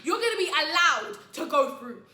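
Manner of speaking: slow, high, very loud insistence; imitating a man who won't back down. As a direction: push every word out loudly. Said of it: "he was so loud".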